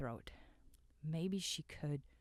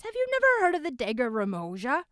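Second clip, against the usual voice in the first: loud, fast, higher; roaring; 10 semitones higher, 13 dB louder, faster than usual. A narrator's reading. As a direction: scold them at full volume. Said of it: "roared a voice".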